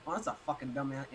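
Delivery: low attempt at British accent; poor imitation of a British accent. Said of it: "I can't do the accent".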